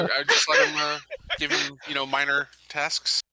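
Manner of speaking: ordinary delivery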